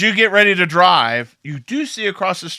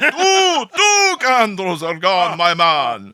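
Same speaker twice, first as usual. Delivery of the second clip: loud, high Jamaican lament; Jamaican man; 5 semitones higher, 6 dB louder than usual. Acted. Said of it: "this large Jamaican man".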